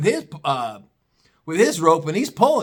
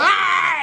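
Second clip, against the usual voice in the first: high, held yelp of pain; mock scream as rope burns the hands; 14 semitones higher than usual. Junior feels the rope rip through his hands, high pained scream. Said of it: "now it's ripping through his hands".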